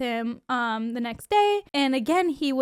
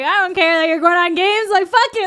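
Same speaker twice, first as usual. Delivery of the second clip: put-on loud, raised drunken re-enactment; sloshed outburst; 7 semitones higher, 9 dB louder than usual. Drunk on the rooftop telling him, loud outburst. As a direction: belt it out like you're happily drunk. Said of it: "I was sloshed and happy".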